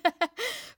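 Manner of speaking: high laugh. Real, not acted. Laughs at teasing.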